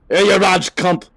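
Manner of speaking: high, loud mock-Scottish yell; drawn-out, hammed-up Scottish road rage; shitty Scottish shout. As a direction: holler abuse in a badly faked Scottish accent. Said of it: "shitty Scottish word".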